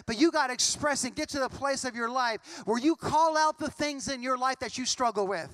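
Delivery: ordinary talk, nothing marked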